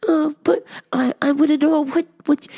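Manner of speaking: very high mock-accented hesitant customer; high-pitched comic caricature; Miss Swan. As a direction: imitate a customer stalling in a high voice. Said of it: "Miss Swan".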